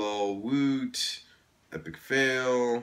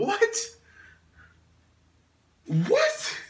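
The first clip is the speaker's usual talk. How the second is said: squeaky high disbelief; high incredulous what. Real, not acted. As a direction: shriek it in disbelief. Baffled at quiz question.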